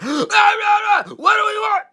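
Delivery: very high, stretched protest shout; mock rally chant, yelled high; excited bullhorn yell. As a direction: mimic an over-excited crowd leader screaming. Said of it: "they got all excited and yell".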